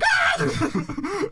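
sudden high yelp of panic, laughing; a startled driver's half-laughing scream; panicked shout. Retold or imitated. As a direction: jolt up and cry out in a laughing fright. Retold panic while driving.

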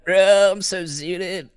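stoner. Pretend. Acting stoned after zooted tag.

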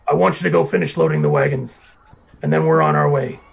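loud, lightly voiced boss; booming voice. Horned boss sending worker to the wagons, lightly booming voice. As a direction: give orders with some boom. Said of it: "it's a really loud, booming voice".

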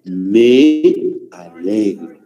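me alegro drawn out slowly, word by word; slow dictation. Shown as a demonstration. Teacher dictating me alegro slowly to students. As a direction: spell out "me alegro" slowly for the class to copy. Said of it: "Aquí sería".

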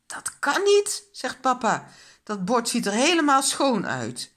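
high, loud disbelief; astonished. A narrator's reading. Papa sees the empty plate, astonished exclamation. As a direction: exclaim it in utter amazement. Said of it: "Papa en mama kijken verbaasd".

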